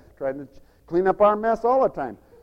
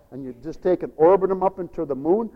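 ordinary talk

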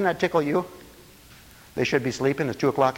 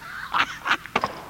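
high, soft breathless laugh; dying-laughing demo; 5 dB quieter than usual. Shown as a demonstration. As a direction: demonstrate a laugh so hard you could die. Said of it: "That kind of laugh".

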